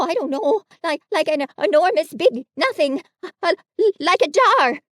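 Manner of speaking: high, loud, fast fumbling description; searching for words in panic; flustered stammer. A narrator's reading. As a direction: Piglet trying to describe the monster. Piglet stammering in fright.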